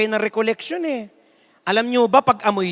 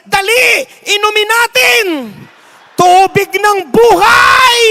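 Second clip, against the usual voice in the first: much higher, much louder, slow last cry; hammed-up loud, high crowd cry; mock fervent shout; 9 semitones higher, 10 dB louder, slower than usual. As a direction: bellow it like a frenzied crowd. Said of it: "baka sabihan".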